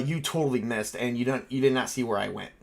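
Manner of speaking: ordinary talk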